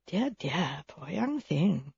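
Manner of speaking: soft, slow tutting sympathy; hushed, lightly acted gossip's pity; mock pity. A narrator's reading. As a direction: cluck softly over the poor bride. Gossip pities the young bride.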